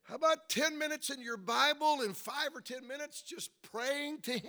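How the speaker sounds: high, loud, urgent appeal; forceful preaching. Real, not acted. Preacher urging daily Bible and prayer, forceful preaching. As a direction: press the challenge home from the pulpit. Challenges congregation.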